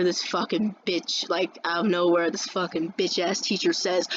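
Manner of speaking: ordinary talk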